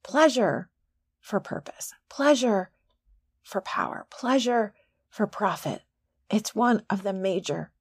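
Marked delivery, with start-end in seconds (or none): 1.2-1.9 breathy whispered tag line; whispered half of a mantra. Real, not acted. Whispered refrain. 3.4-4.1 breathy whispered tag line; whispered half of a mantra. Real, not acted. Whispered refrain.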